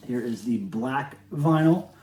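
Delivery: normal talking voice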